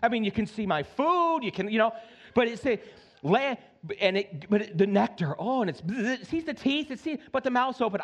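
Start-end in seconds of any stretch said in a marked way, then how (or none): none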